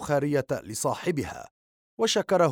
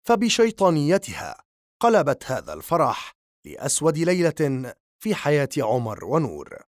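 ordinary delivery, nothing marked

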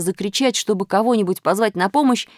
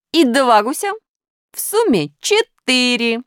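higher, louder, stretching to the total; raised, emphatic counting, slowing at the end; Plus counting aloud; 6 semitones higher, 4 dB louder than usual. A narrator's reading. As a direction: announce the sum like a magic trick.

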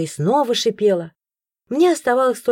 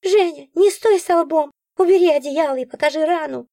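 bossy creature; 8 semitones higher than usual. A narrator's reading. Nora ordering Zhenya about, high impatient. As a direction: hurry her along.

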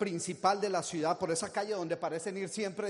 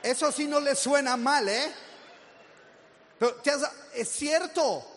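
higher, louder, nearly shouting; loud emphatic; 5 semitones higher, 5 dB louder than usual. Real, not acted. Speaker to audience, loud emphatic. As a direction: drive the point home loud. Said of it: "shout heard".

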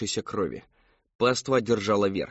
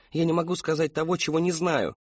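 high-pitched, pressured denial; distressed protest; 7 semitones higher than usual. A narrator's reading. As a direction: protest helplessly in a raised pitch. Daughter protests under pressure.

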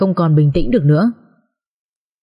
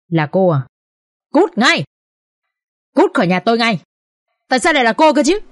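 higher-pitched outrage, restrained volume; angry shout; 3 semitones higher than usual. A narrator's reading. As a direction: narrate a furious woman throwing someone out. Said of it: "hét lên giận dữ".

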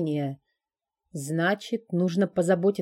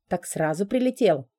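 ordinary delivery, nothing marked

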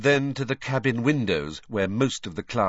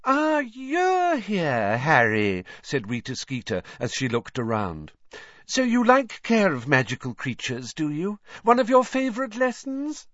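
Rita's voice; 8 semitones higher than usual. A narrator's reading. Rita fawning over Harry.

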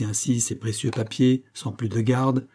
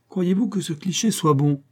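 a touch of mockery, pitched up; mocking voice; 7 semitones higher than usual. A narrator's reading. Zigomar asks, lightly mocking from behind. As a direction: tease him lightly from behind. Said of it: "une voix railleuse lui demanda".

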